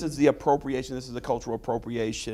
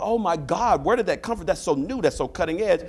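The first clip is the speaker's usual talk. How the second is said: higher, lightly hammed-up amazement; mock gushing; 7 semitones higher, 3 dB louder than usual. Professor mocks people praising appropriated style, higher mock amazement. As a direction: fawn over something as brand new.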